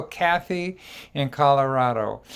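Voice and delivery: ordinary talk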